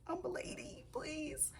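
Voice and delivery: high, hushed little lady voice; a pleading, playful lady begs sweetly; mock begging lady. Vlogger begging viewers to subscribe, in a hushed high lady voice.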